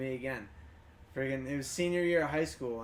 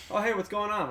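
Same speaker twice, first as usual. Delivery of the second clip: higher, imitated cheery greeting; real happy; 5 semitones higher, 4 dB louder than usual. Greeting an old schoolmate in the store, happily re-enacted. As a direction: greet him like you're real happy to see him. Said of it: "Like, real happy".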